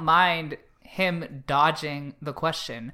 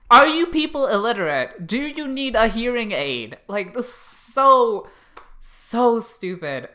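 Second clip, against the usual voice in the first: exasperated rant; 5 semitones higher, 5 dB louder than usual. Real, not acted. Ranting about the trope.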